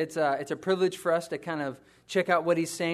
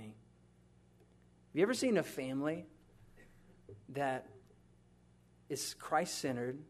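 tender hushed musing; 4 semitones lower, 9 dB quieter than usual. Acted. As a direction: draw them in gently with each pause.

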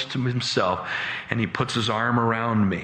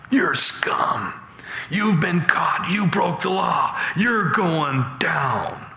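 hammered-out mock accusation, raised pitch; accusing bailiff; 6 semitones higher, slower than usual. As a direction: berate the accused, drawing out the final verdict.

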